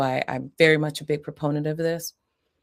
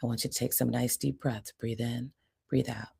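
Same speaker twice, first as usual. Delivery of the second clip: soft, soothing breathing cue; calm coaching voice; 4 semitones lower, 8 dB quieter than usual. Shown as a demonstration. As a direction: talk them gently through a breath. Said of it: "Or I might say".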